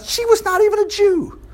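high-pitched, astonished aside; high, incredulous stress; amazed emphasis. Real, not acted. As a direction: pitch it way up to marvel at it. Amazed aside about the woman.

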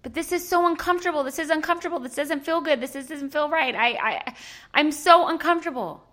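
put-on the inner toddler complaining about the discomfort; whining toddler. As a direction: whine like a fussy child who can't stand it. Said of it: "conversation in your head".

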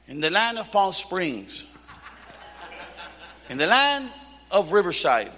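playful solemn Bible reading; scripture reading. Real, not acted. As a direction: read the verse with weight.

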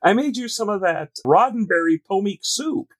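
a nurse bringing Gene soup, high and doting; sweet nurse voice. Pretend. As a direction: coo it like a devoted wife.

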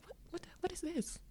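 a mock-bewildered high squeak of disbelief. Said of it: "I mean, I was like".

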